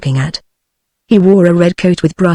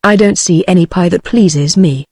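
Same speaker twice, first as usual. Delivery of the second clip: ordinary delivery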